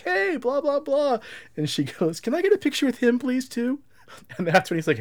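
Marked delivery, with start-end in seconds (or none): 0.0-1.2 much higher, cheery throwaway greeting; a breezy high celebrity hello; mock Bruce hello. Bruce greeting the wife, high and breezy. 2.2-3.8 much higher, small pleading voice; timid wife plea. Retold or imitated. Wife asking Bruce for a photo, high and sheepish.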